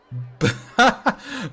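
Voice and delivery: a burst of high laughter at herself. Real, not acted. Laughing at her own failed German accent, high burst of laughter.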